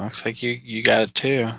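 ordinary talk, nothing marked

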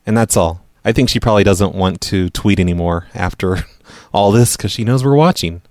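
ordinary talk

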